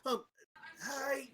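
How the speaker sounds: high, breathless flustered woman; a startled wife caught hugging, squeaking high; flustered tabaxi. Acted. Flustered snow leopard wife stammering to the party.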